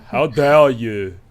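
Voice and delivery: mock outrage in a bad French accent. Craucus taking offence at his friends, in a bad French accent. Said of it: "trying to do a French accent horribly".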